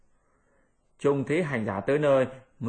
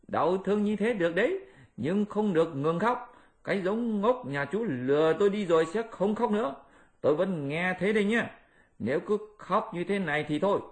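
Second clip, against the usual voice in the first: high threatening monkey voice; high Monkey King, mock threat; Monkey King warning; 5 semitones higher than usual. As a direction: warn the pig not to stop. Monkey warns Pigsy.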